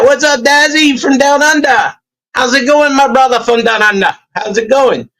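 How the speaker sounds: high-pitched, hammy Australian greeting; bad Australian accent. Shown as a demonstration. Greeting a mate from Down Under, bad Australian accent. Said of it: "that's my attempt at a shitty Australian accent".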